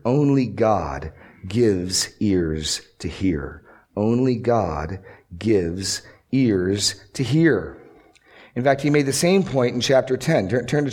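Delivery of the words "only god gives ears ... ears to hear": slow. Shown as a demonstration. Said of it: "Point I here".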